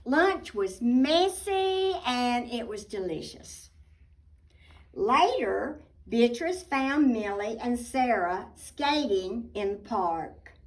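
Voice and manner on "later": plain reading voice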